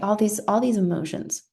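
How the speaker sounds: ordinary delivery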